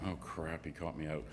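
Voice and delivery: low, very soft mock worry; muttered aside. Promoter caught out at heaven's gate, muttering under his breath. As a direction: mutter it to yourself, low and hushed.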